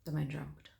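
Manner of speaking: usual speaking voice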